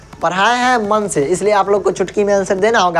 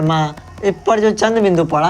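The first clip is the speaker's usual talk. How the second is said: usual speaking voice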